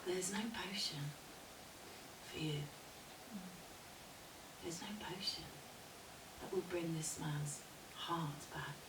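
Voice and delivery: soft, measured, long pauses; calm wise woman. Acted. Crone to the upset woman after burning the hair, calm. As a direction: explain it gently, quietly. Crone calmly explains no potion.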